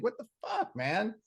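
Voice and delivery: imitating a stunned buddy, squeaked; mock baffled squeal. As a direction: pitch the friend's shock way up. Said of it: "He's like".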